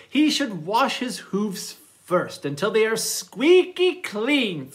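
animated storytime narration with big swings in pitch; reading to children. Acted. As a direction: read a playful picture book to little listeners. Reads manners story to children.

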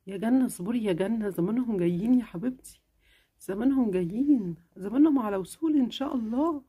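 mother answering her sick daughter, tenderly; caring mother. Acted. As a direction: soothe your little girl. Mother calms impatience.

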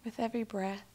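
calm, unhurried affirmation with long silences after; spoken affirmations. Acted. Guide giving affirmations for listeners to repeat, calm meditation voice. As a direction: say each statement gently, leaving room to repeat it.

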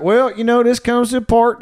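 hammed-up country doctor breaking bad news; folksy doctor warning. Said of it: "he was like".

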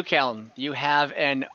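ordinary delivery

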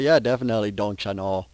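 a hint of the Minnesota accent; Minnesotan accent. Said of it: "You know, the Minnesotan accent".

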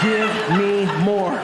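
slow, drawn-out command; demanding director. Retold or imitated. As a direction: stretch each word as a demand. Director demands more energy.

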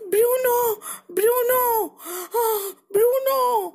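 high, anxious calls with gasps; worried calling. Acted. Mama searches for her lost bear cub, worried calling. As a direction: call out for a missing child in alarm.

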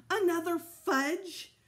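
put-on boy yelling at parents, disgusted; shouting. A narrator's reading. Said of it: "I kept right on shouting".

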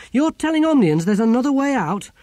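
high, loud outraged question; indignant disbelief. Acted. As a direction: object as if betrayed. Ern outraged at sharing secret.

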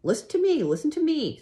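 very high, loud goose cry; screamed. A narrator's reading. Goose demanding Wilbur's attention, high scream. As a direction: scream it to get heard. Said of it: "screamed the goose".

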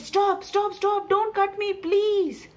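high, loud, urgent plea; high-pitched cry of a talking tree; pleading tree. Acted. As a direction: beg frantically for your life. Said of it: "when the tree said".

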